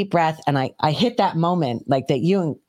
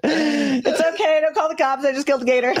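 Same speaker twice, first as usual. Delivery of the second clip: high, excited calling out; giddy announcement; 9 semitones higher than usual. Retold or imitated. Woman tells neighbors about the gator, high and giddy. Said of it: "I'm going around the neighborhood".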